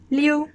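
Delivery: Liu like Leo run together, high; conjoined sounds. Shown as a demonstration. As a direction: say Liu as one joined syllable, high. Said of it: "but more conjoined together".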